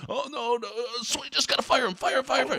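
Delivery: high, mocking fan chant of fire; mocking angry fan. Podcast host mocking fans who demand the firing, in a high put-on voice. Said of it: "pound the table and say".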